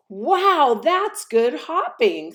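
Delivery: high, loud amazed little hare; awed wow. Acted. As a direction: marvel at the big jump.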